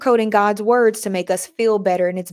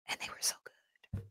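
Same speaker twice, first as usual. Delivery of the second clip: barely voiced whisper; hushed, grieving whisper; 20 dB quieter than usual. Real, not acted. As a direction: whisper it in sorrow. Sad memory of concert.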